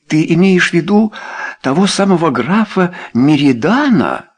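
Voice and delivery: high-pitched, incredulous question; astonished exclamation. A narrator's reading. Wife asking about the famous count, astonished. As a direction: ask it aghast. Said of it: "воскликнула леди Белвиль".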